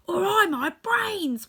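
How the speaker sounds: high, loud, drawn-out wail. A narrator's reading. Scarecrow laments his lost promise, wailing high. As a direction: lament it slowly at a high pitch. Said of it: "wailed the Scarecrow".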